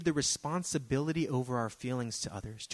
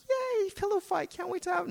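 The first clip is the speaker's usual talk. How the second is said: squealed, much higher mock excitement; a hammed-up delighted squeal; mock glee. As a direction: gush like a thrilled kid at a sleepover. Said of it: "Some of you are like".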